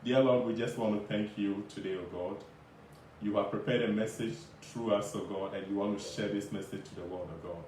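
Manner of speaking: fast, hushed prayer fading at line ends. Acted. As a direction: pray quickly and softly. Opening prayer before message.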